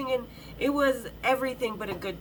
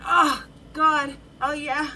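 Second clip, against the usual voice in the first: loud, breathy "Oh, God, oh, yeah" like an ecstatic moan; pleasured moan; 3 semitones higher, 7 dB louder, slower than usual. Shown as a demonstration. Telling the crowd what a good moan sounds like, loud ecstatic moan. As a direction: cry out "Oh, God" as if it feels great. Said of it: "kind of way".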